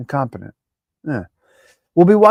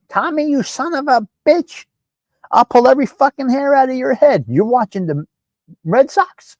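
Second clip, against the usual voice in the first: high, shrill-free mock mother's yell, voice pitched up; angry mother yelling; 7 semitones higher than usual. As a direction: imitate a furious mother scolding her son. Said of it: "She will yell at".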